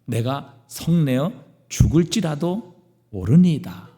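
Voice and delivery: ordinary talk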